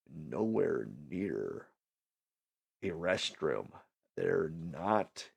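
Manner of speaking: hushed, low retold worry; quietly reliving needing a restroom; retold panic. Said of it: "And I'm like".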